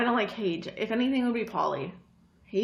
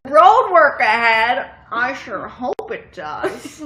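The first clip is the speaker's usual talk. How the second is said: hammed-up Vine quote; 2 semitones higher, 9 dB louder, slower than usual. Acting out favorite Vine line.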